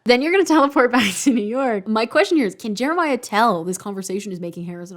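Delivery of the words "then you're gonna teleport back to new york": laughing. Retold or imitated. Rereading line with amused laugh.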